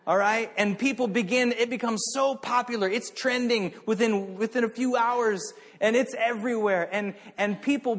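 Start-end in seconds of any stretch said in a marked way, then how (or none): none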